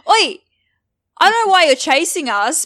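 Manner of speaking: loud Australian call-out; accidental Australian accent. Acted. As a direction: shout it in a broad Australian accent. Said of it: "so why am I Australian?".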